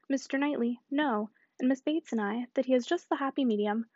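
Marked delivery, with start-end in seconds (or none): none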